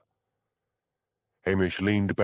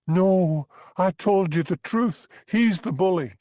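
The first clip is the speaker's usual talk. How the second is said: high, loud indignant denial; protesting; 12 semitones higher, 5 dB louder than usual. A narrator's reading. Bella pleading with the constable, loud high denial. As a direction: insist shrilly on your innocence.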